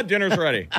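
high sing-song call; calling the kid to dinner; yelling across the room. Pretend.

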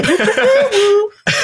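big high laugh. Real, not acted. Laughing after song bit.

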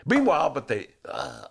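normal talking voice

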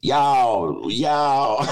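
drawn-out mock Southern "y'all"; bad Southern accent. Host mocking the movie's bad accent, hammed-up Southern. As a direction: do a clumsy Southern drawl. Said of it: "how I do a Southern accent".

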